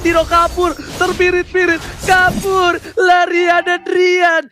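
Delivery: excited play-by-play. Real, not acted. Caster calls chase excitedly.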